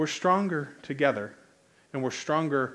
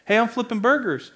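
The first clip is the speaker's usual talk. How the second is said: cheery call out; 8 semitones higher, 6 dB louder than usual. Retold or imitated. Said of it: "pulling people into what I'm doing".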